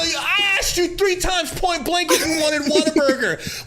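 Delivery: high, loud, hammed-up yelling; mock-outraged high-pitched protest; exasperated protest. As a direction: plead your case at a comic shout. Retelling exasperated argument with wife.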